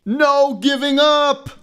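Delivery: high, loud, stretched cry; rally the crew to hang on; slow, loud rallying yell; determined shout. A narrator's reading.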